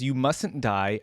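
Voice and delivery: ordinary talk, nothing marked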